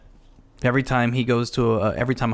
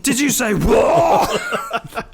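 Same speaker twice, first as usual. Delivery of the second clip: high and loud, stretched out, breaking into laughter; incredulous question collapsing into a laugh; laughing mock question; 10 semitones higher, 4 dB louder, much slower than usual. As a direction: ask it through a burst of laughing. Retelling Holmes' baffled reply.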